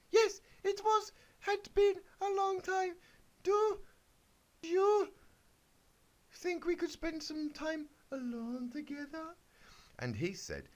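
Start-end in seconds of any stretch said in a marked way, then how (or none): 0.1-9.4 very high, sweet little pony voice; squeaky Twilight. Acted. Twilight answers the wizard in a very high, happy squeak. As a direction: chirp it like a toy pony.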